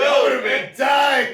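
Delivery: loud, slow, excited exclaim. Real, not acted. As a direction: hype it up with a laugh. Excited reaction, laughing.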